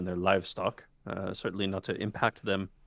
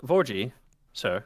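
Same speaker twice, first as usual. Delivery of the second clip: louder call after someone leaving; 4 semitones higher, 9 dB louder, slower than usual. Acted. Quintus calls after Vorji as he walks out, louder. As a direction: call out to catch him at the door. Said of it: "and he says".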